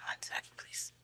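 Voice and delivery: real whispered plea for a moment. Real, not acted. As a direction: breathe it out, no voice. Too emotional to go on.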